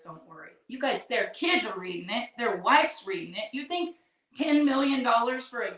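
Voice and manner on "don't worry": much softer, trailing away; fading mock reassurance. Friends telling Barb not to worry, trailing off softly. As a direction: let it die off to almost nothing. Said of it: "everybody says".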